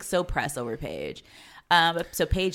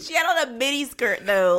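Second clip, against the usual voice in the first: normal talking voice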